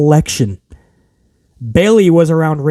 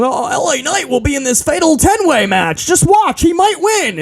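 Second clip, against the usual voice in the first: higher-pitched mock promoter pitch; mock hype; 8 semitones higher than usual. Mocking bookers stringing fans along, high mock hype. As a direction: sell it like a fake teaser.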